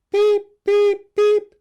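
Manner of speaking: very high, loud peeps; calling baby bird. Acted. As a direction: call for help like a chick. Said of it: "called the birdie".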